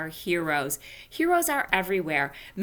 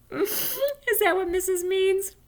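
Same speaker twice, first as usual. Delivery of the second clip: high laughing question; 13 semitones higher, slower than usual. Real, not acted. Teacher laughing while asking the class about the story. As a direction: laugh through the line.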